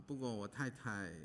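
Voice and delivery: normal talking voice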